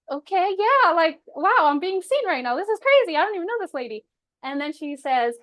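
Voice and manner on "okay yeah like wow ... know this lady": high, loud gush of disbelief; wow, being seen, voice flying up; amazed and thrilled. Retold or imitated. Telling the psychic she feels seen, high and amazed. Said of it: "And I was like".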